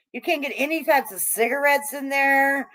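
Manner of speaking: ordinary talk, nothing marked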